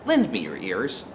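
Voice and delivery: soft, measured Antony, coaxing the crowd; Antony soothes a hostile crowd, soft and gentle; gentle oratory. Acted. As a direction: start very gently, win them over. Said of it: "he starts out very gently".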